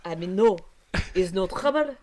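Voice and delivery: put-on flustered, halting French accent; hesitant French character voice. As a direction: stumble through a reply in French-accented English. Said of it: "this stupid French voice".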